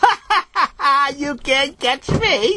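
high, slow, cackling singsong tease; cackling taunt. A narrator's reading. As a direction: cackle and taunt, kid in flight. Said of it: "he gave a big cackle of laugh".

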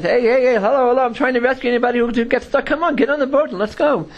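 high-pitched, urgent mock hail; rescuer calling out. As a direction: play a rescuer hailing someone from a boat, not shouting.

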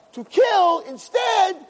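high, slow shouted climax; preaching shout. Acted. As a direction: hammer the point home. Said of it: "preaching climax".

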